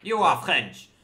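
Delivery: loud, high insistence; emphatic confirmation. Real, not acted. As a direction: declare it proudly. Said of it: "That actually was on point".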